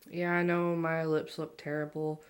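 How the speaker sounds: normal talking voice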